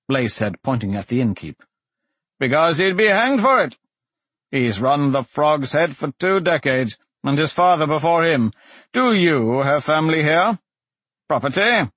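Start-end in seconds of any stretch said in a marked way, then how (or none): 2.4-11.9 higher-pitched voice of a questioning judge; stern magistrate. Acted. Magistrate cross-examines the accused before a crowd, stern. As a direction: press the prisoner from the bench.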